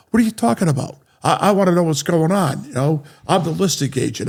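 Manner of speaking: upset agent. Retold or imitated. Real estate agent confronting Jerry about the offer, high and agitated. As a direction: let the pitch jump up in protest.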